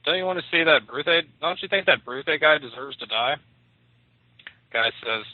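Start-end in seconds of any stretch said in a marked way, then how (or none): 0.1-3.4 higher, louder call to a friend; pitched-up hail, pressing him with questions. A narrator's reading. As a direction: call out in a raised, incredulous voice. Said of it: "called out to him".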